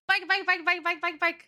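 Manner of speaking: high, loud barking voice; playful dog barks. Pretend. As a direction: bark like a yappy dog.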